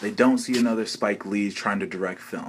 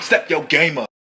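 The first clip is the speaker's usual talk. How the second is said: loud, higher scolding; scolding shout; 4 semitones higher, 7 dB louder than usual. Acted. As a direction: bark it at him like a fed-up coach. Scolding the complaining actor.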